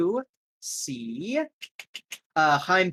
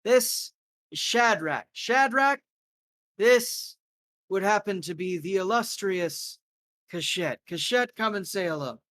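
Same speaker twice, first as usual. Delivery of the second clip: higher, showy host introducing guests; lightly raised gracious host voice; courteous host; 4 semitones higher than usual. Acted. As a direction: present the guests with a flourish.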